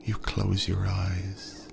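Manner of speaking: ordinary delivery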